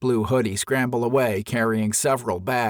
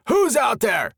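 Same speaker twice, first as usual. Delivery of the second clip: much higher, shouted demand; angry shout; 12 semitones higher, 4 dB louder than usual. A narrator's reading. Camper shouting at whoever is outside, angry shout. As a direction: shout out at the unseen intruder. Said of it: "yelled from within".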